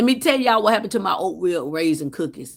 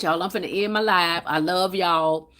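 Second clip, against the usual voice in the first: ordinary talk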